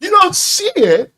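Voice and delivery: high, drawn-out disbelieving question; slow squeal of disbelief; incredulous echo. Real, not acted. Partner doubting the fix was missed, incredulous.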